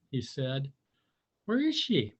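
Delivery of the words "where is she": high, kind question; Tudley asking. A narrator's reading. Tudley asks the tadpole, high and kind. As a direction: ask gently, a bit high. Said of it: "asked Tudley".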